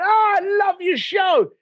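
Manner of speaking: mock fan rushing up excited; gushing fan. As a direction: gush like an eager fan.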